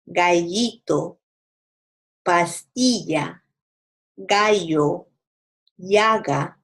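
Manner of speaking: ll in Pasillo, calle, gallito said as a clear y; y sound for ll. Shown as a demonstration. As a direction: pronounce every ll like English y. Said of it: "That's with the ella sound".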